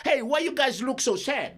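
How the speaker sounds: loud, high, slow bossy call; hammed-up boss scolding the workers. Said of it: "Sini ber sartu yo".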